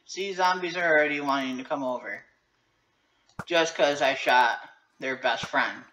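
ordinary delivery

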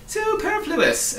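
very high, drawn-out pronouncement; high-voiced advice. A narrator's reading. Count advising Milo on his car, high and drawn out. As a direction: stretch the word out loud and high. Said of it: "advised the Count".

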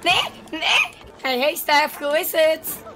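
usual speaking voice

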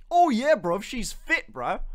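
high, loud mock roadman slang. Voicing what mum wouldn't say, mock roadman. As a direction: put on a hammed-up roadman voice. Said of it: "what roadmen sound like".

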